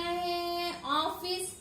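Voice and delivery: slow, deliberate teacher dictation; drawn out, every syllable spaced for children. Shown as a demonstration. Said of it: "जो book में दिया है office लिखने का". (धीरे-धीरे, बच्चों को लिखवाते हुए)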